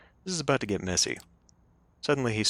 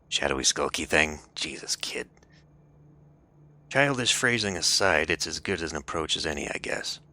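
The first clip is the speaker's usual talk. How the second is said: gravelly voice; 3 semitones lower, 3 dB louder than usual. Acted. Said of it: "the gravelly voice sure does".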